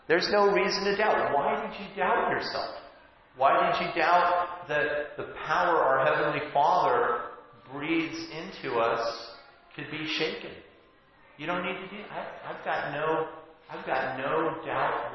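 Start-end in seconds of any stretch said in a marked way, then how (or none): none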